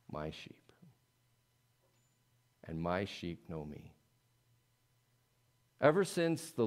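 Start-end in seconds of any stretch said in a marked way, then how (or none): 0.1-3.9 hushed. Acted. Jesus quoted, hushed.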